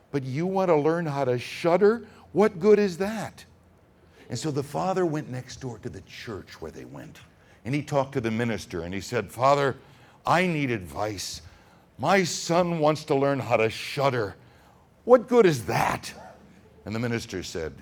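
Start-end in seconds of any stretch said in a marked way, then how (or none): 0.1-3.4 loud, high, fed-up scolding; a father who can't believe his ears; exasperated father. Acted. Father scolding his son, loud and exasperated. 9.3-16.1 loud, high, pleading complaint; begging the minister for help, raised voice; worried father. Acted. Father asking the minister for advice, loud and upset.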